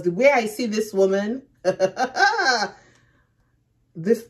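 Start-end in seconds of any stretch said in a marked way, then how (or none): 1.6-2.7 short, loud burst of laughter; laughing. Real, not acted. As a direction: let out a sudden laugh. Nervous laugh at the scary woman.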